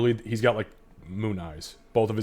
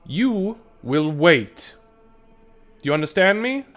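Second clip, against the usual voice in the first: priestess scolds the gnome, higher and louder; stern priestess; 10 semitones higher, 9 dB louder than usual. Acted. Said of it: "She kinda looks over you guys and he goes".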